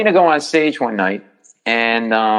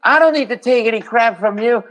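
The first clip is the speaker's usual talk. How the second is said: put-on angry bleeding drunk; 11 semitones higher than usual. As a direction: snap back at the comic like he insulted you. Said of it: "he turns to me".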